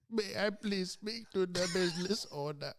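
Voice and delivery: high, hammed-up tearful caller accent; mock crying. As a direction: fake being on the verge of tears. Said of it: "acting like he was crying".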